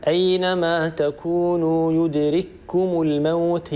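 يدرككم said slowly with the two kafs merged into one doubled kaf; slow recited example. Shown as a demonstration. Teacher reciting the verse to show merged kaf. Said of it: "ندغم الكاف الأولى الساكنة".

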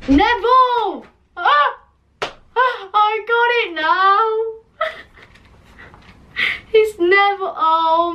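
sudden high, delighted cry of discovery; a real eureka moment, high and bright; excited realization. Real, not acted. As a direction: it finally clicks, squeal it out. Realizing the misspelled name.

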